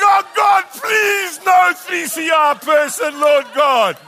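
very high, loud, desperate pleading; frantic prayer. Retold or imitated. Wax in his nose, praying nobody walks in, frantic. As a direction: shriek a prayer in comic terror.

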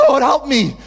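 high, loud plea shouted to God; desperate crying out. Acted. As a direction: cry out for help with all your heart. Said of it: "she's crying out to God".

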